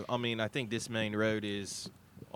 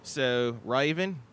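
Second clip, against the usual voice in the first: put-on Australian accent; Aussie accent. Host taking on an accent for the show, Aussie. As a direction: speak as a chatty Aussie host. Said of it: "in an Aussie accent".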